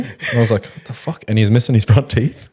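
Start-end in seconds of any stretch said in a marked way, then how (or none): 0.7-1.2 stunned whisper, acted out; whispered disbelief. Storyteller reacts to mate's missing teeth, whispered disbelief. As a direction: whisper it under your breath in shock. Stunned aside at toothless mate.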